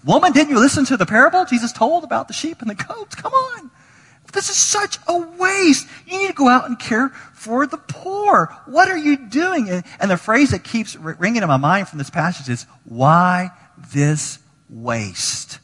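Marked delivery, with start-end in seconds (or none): 0.0-9.7 indignant disciples. Retold or imitated. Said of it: "They're like". 12.8-15.6 drawn-out echo. Acted. Phrase ringing in preacher's mind.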